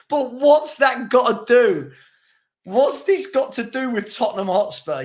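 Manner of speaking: exasperated shout. Real, not acted. Exasperated at comment.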